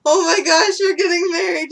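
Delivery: high, loud mock-tearful gush; imitating tearful friend. Bride retells her friend bursting into tears, mock crying. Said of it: "she starts crying".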